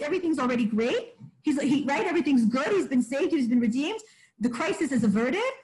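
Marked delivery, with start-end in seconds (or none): none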